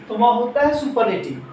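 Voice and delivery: usual speaking voice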